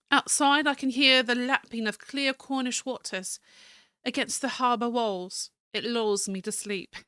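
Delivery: American attempting a refined British accent; fancy British accent. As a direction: read the journal in fancy British English. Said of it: "Fancy British".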